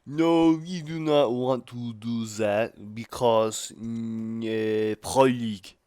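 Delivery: slow, exaggerated French-Canadian accent. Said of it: "me saying Pro League in a French-Canadian accent".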